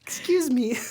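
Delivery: very high, loud mimicked princess voice; mock princess.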